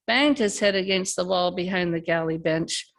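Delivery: usual speaking voice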